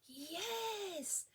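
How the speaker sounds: gasp, then a long high swooping yes; thrilled yes. A narrator's reading. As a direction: breathe out a long, delighted yes. Magic has worked.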